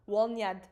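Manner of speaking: "Wolniad" said loud and flat as the dialect form; dialect word. Shown as a demonstration. Showing chat the dialect word for girl, loud and clear. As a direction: announce the dialect "Wolniad" plainly. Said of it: "how you say it in dialect".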